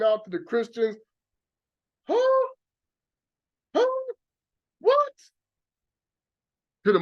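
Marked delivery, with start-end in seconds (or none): 2.1-5.1 squeaky, much higher mock astonishment; mock shock. Preacher mocking Christians stunned by new knowledge, in a high mock squeal. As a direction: play the stunned listener, high and disbelieving.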